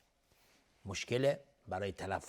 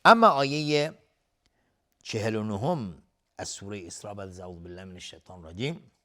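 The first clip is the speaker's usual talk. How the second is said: usual speaking voice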